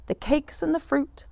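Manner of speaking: ordinary delivery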